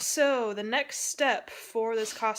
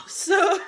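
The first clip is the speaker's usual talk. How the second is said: sudden loud laugh; loud burst of laughter; 10 semitones higher, 9 dB louder than usual. Real, not acted. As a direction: crack up out loud. Laughs at friend choking.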